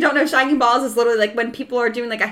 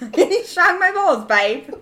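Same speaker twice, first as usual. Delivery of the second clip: slow mock British, laughing; cheeky imitation of a British accent; 3 semitones higher, slower than usual. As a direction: draw it out in a joking British accent. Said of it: "that's how my sister says her British accent".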